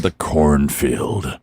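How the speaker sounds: very slow, low title announcement; softly intoned horror-story title; mock-spooky title. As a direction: draw it out low and ominous.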